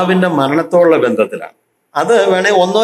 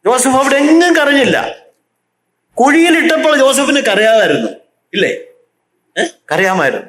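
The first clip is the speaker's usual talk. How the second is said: high, insistent pulpit cry; preaching at full force; 8 semitones higher than usual. Acted. Preacher describing Joseph's pit to his congregation, fervent and higher. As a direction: hammer each image home to the church.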